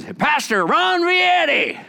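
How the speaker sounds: loud call across the store, high and slow; hammed-up shout of a pastor's name; loud greeting. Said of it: "he's a real kind of loud guy".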